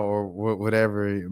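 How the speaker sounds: ordinary delivery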